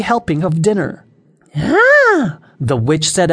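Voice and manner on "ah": high, loud delighted ah; admiring. Acted. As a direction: gasp with delight at the food. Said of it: "admiring the fine cooking".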